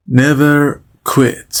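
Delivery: Never quit said very slowly, every sound drawn out; slow model phrase. Shown as a demonstration. Teacher drawing it out slowly so students can repeat. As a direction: spell it out slowly for learners. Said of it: "teacher models phrase slowly".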